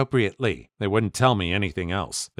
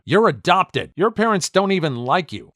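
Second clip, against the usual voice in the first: mild mock yell, pitched up; higher, teasing shout without full force; narrated yell; 7 semitones higher than usual. A narrator's reading. Yelling at a friend while bowling, mild mock yell. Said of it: "I yelled at him".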